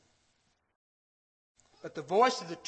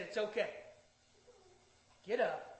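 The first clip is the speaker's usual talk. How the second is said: hushed, gentle comfort with long pauses; softly calm someone who is frightened; tender reassurance; 10 dB quieter than usual. Acted. Jesus reassuring frightened disciples.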